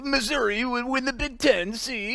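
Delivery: high-pitched goofy caricature that comes out like Borat; mock SEC fan. Host mocking SEC fans to his co-host, high Borat-like caricature. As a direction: jeer in a silly, very high put-on voice. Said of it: "sound like Borat".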